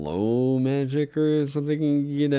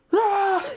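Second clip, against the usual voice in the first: a short high screech like a whistling stone in flight; mock shriek; 4 dB louder than usual. Streamer imitating the shriek rock being thrown, mock screech. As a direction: shriek out the rock's cry, hammed-up. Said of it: "it just like".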